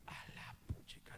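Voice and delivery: breathy whispered oath; soft unvoiced a la puchica; whispered exclamation. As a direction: hush the curse under your breath. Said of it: "le digo".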